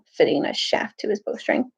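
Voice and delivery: plain reading voice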